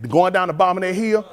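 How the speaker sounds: ordinary talk, nothing marked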